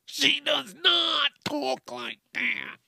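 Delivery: high, drawn-out mom caricature; mock mother voice. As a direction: deny it slowly in the silly high mom voice. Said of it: "I'm not gonna do my mother's voice".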